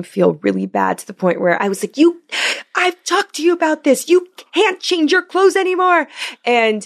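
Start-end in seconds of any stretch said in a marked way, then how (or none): 2.0-6.1 put-on high-pitched scolding of a child; losing it at a kid who keeps changing clothes; scolding mom. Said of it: "lost it on him the other day".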